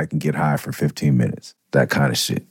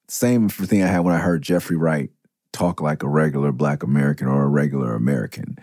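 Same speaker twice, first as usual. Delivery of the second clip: ordinary talk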